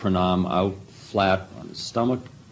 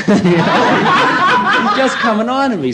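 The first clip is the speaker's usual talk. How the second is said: high laughing burst as he talks; real amused laughter at the memory; 7 dB louder, slower than usual. Real, not acted. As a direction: laugh through the words. Laughing about the guru's teasing.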